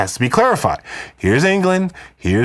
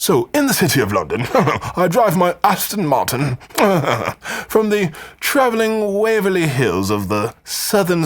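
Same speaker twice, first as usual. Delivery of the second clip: posh British lord; 5 semitones higher than usual. Pretend. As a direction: play a pompous British baron bragging. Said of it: "so that you sound like a British lord or baron".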